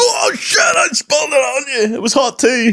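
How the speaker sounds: high, wailing mock shout; mock panicked cry. Clumsy waiter spilling on a customer, mock panicked high cry. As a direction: cry out in hammed-up horror.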